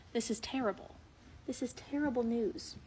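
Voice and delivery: mock-tragic whisper; hushed despair. Real, not acted. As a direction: lament in a whisper. Whispering to the foal.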